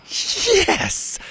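high, hushed little cheer; quiet celebration. Acted. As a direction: celebrate without being heard. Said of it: "Zeta quietly celebrated".

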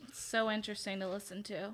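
soft, quick deadpan sarcasm; sarcastic. Real, not acted. Co-host answering about the census reading, sarcastic. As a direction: feign interest, dryly and quietly. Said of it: "cannot capture the sarcasm in [name]'s voice".

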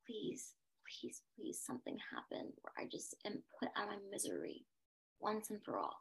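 barely audible whispered begging; hushed, worn-out imploring; retold desperate plea. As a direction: plead under your breath, as in a private prayer. Retells her desperate inner plea.

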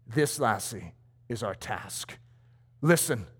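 low, hushed, lightly voiced old man; old missionary. Old missionary confides the task to the lassie, hushed and low. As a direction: drop to a quiet, solemn old mentor.

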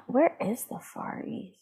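hushed, unvoiced slow question; whispered. Real, not acted. Asking co-host about Far East, whispered. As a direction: whisper it like a conspiratorial aside.

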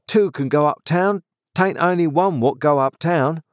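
high-pitched rustic grumble; aggrieved journeyman. Acted. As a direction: argue back as a sulky workman. Orlick argues back.

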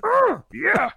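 very high seductive pig voice; Miss Piggy. Acted. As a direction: coo it like a diva pig. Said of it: "doing a Miss Piggy".

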